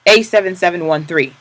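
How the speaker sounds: number called out loud. Acted. Capo calling out the prisoner's number, loud. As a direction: shout the number across the yard.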